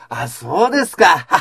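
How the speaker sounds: loud, slow laughing reply; laughing shout. Retold or imitated. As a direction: burst out laughing as you answer the boss.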